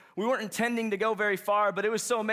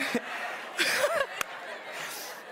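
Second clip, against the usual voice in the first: high breathy burst of laughter; real laugh; 4 dB quieter than usual. Real, not acted. Speaker keeps laughing.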